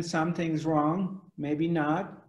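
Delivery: normal talking voice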